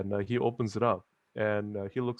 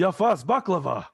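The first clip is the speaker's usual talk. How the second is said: delighted recognition; 8 semitones higher, 9 dB louder than usual. Acted. [name] remembers the famous baklava.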